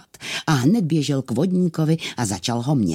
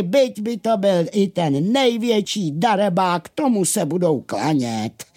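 animated, higher miller's voice; miller's worldly grumbling; 8 semitones higher than usual. Acted. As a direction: voice a miller holding forth.

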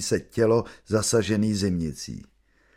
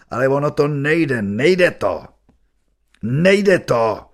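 rising despair, louder each time; 3 semitones higher, 4 dB louder, slower than usual. A narrator's reading. Father at his son's side, despairing, louder and louder. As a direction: say it to yourself in rising despair, voice straining. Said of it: "říkám si zoufale".

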